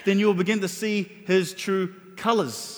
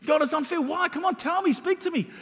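pleading demand; 10 semitones higher than usual. Acted. Job demanding answers from God.

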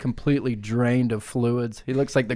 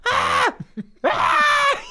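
mock pained scream. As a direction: shriek like your legs are crushed, half-laughing. Q crushed by Grimace.

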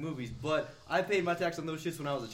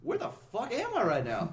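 slow, higher, lost and baffled; confused; 7 semitones higher, slower than usual. Retold or imitated. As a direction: wonder aloud where you are. Retold confused reaction.

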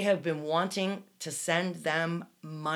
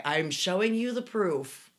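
usual speaking voice

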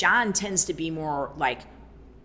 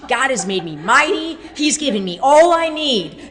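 higher and louder triumphant claim; mighty declaration; 7 semitones higher, 9 dB louder than usual. Retold or imitated. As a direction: declare strength while pushing weight. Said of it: "She'll be like".

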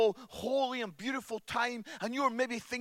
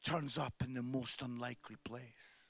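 much softer, a hushed whisper of the refrain; 10 semitones lower, 10 dB quieter than usual. Real, not acted. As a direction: whisper it like a secret.

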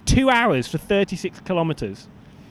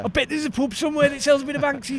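Aussie tradie, pitched up; chirpy Aussie bloke; aussie tradesman; 6 semitones higher than usual. Acted. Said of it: "it's Brian".

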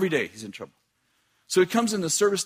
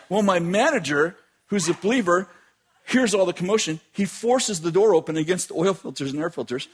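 ordinary talk

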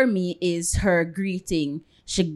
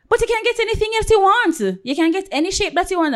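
high, fast, forceful comeback; strong retort; 13 semitones higher, 4 dB louder, faster than usual. Retold or imitated. Salon boss to a client asking about nail shape, high forceful retort. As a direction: imitate an overly strong, hurried reply. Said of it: "why is so strong".

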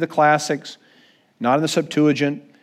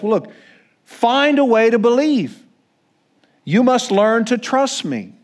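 demanding Jesus; 7 semitones higher than usual. Pretend. Imagined Jesus taunting sinking Peter, a stern mock challenge. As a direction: lean in, daring Peter to prove himself. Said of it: "I can imagine a scene where Jesus says".

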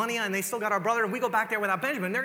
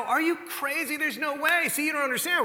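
indignant brothers; 9 semitones higher than usual. Retold or imitated. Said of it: "And these guys go".

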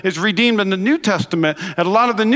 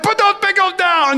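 high, raised mock scold; a hammed-up parent telling the son off, pitched up; mock parental order; 9 semitones higher than usual. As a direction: play the exasperated parent. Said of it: "we're like".

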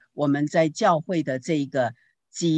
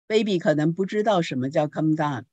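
ordinary delivery

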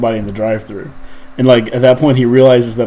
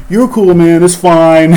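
imitating the fired cook; 6 semitones higher, 7 dB louder, slower than usual. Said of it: "and he's like".

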